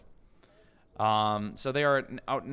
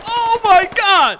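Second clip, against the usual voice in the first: loud, slow, much higher cry; shocked shout; 18 dB louder, slower than usual. Real, not acted. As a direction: cry out in disbelief. Shocked at the fight.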